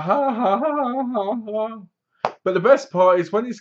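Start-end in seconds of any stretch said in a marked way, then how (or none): 0.0-1.8 high hammed-up villain laugh, ha ha ha; mock evil laugh. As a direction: cackle like a smug villain gloating. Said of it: "Jordan's like".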